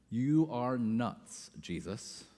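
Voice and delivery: slow, soft, half-amused disbelief; disbelieving disciples. Retold or imitated. Disciples doubting Jesus, slow soft disbelief. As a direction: mutter it like the idea is crazy. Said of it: "is the next line".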